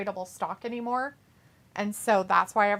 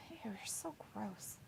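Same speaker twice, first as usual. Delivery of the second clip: whispering; 21 dB quieter than usual. Real, not acted. Teasing reaction to a chat comment.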